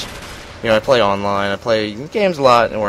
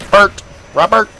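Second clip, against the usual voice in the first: Bert? Robert? called out higher and louder, rising; calling out Bert; 11 semitones higher, 7 dB louder than usual. Shown as a demonstration. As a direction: call Bert and Robert like across a room. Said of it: "if you just say".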